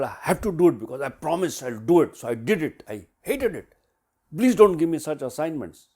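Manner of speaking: loud, heated retelling of a fed-up player; angry complaint. Retold or imitated. Player protesting to coach about the assignment, angry. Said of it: "she had a real angry wooden face".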